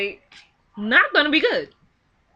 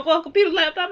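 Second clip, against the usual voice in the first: ordinary talk, nothing marked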